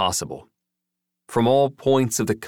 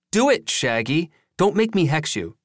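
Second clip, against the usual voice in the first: high-pitched, pushy teasing order; playful bossy threat; 8 semitones higher than usual. A narrator's reading. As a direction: boss a friend around with a mock threat, pitched up. Joey threatens Jason into posing.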